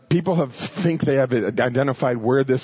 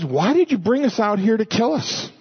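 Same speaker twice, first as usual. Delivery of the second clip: whining; 7 semitones higher than usual. Retold or imitated. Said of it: "their answer is".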